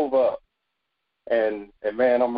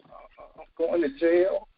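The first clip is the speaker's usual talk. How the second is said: imitating a whimpering cry, higher and a bit softer; mock crying whimper; 6 semitones higher, 3 dB quieter, slower than usual. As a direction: whine it out like a scared kid about to cry. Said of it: "That's exactly how I said it".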